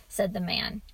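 plain reading voice

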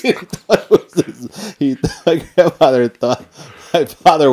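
high, loud words through laughter; laughing. Real, not acted. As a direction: talk while cracking up.

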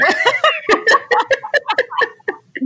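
a sudden, high-pitched laugh that falls away; real amused laughter; high burst of laughter. Real, not acted. Laughing at own nerves.

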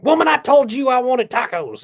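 high, loud mock demand; playfully bossy husband shout; jokey mock yell. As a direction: holler it high as a running joke. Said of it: "And just jokingly, I was like".

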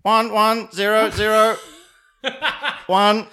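high, loud robotic Dalek voice. Shown as a demonstration. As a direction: grate it out like a Dalek. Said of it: "It's a Dalek".

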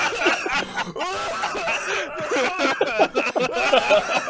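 loud high howling laugh; a real, loud howling laugh; loud laughing fit. Real, not acted. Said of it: "I just spit my water".

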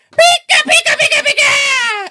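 very high, loud, squeaky chant; squeaky villain voice. Retold or imitated. Big hulking villain Pika speaking in a squeaky voice. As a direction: squeak it out like a tiny-voiced brute. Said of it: "he talks like this".

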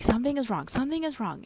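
high, falling, urgent repetition; retold anxious insistence. Anxious wife insisting to husband, high and urgent. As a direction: insist on it, pitch swooping up.

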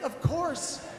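ordinary delivery

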